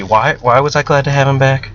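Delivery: plain reading voice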